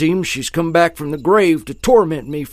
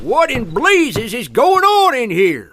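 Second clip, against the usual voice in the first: startled outburst; 6 semitones higher, slower than usual. Acted. Owner bursts in, startled.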